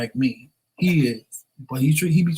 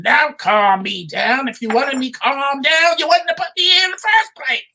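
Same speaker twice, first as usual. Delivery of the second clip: mock angry husband; 9 semitones higher, 3 dB louder than usual. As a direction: snap it back at her. Husband's angry retort retold.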